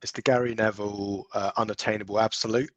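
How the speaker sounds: ordinary delivery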